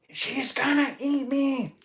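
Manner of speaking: high, drawn-out mock scream; cartoonish squeal of terror; play-acted panic. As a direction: wail in a put-on voice as if about to be eaten. Acting Pumbaa fleeing in panic.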